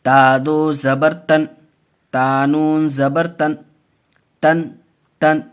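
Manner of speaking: slow, measured syllable-by-syllable drill; deliberate teaching chant; Qaida spelling recitation. Shown as a demonstration. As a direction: recite each letter and vowel for learners. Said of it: "देखिए कैसे पढ़ेंगे".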